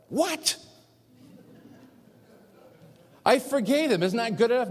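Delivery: high, loud mock outrage; incredulous protest. Man objects to God's order to write a letter, acted incredulous.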